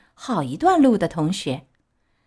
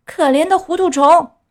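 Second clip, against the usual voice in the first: high, loud scornful cry; boy yells his pity at the puppet; 9 semitones higher, 7 dB louder than usual. A narrator's reading. Boy mocks Pinocchio's plan, shouting high and loud. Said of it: "有一个孩子大叫".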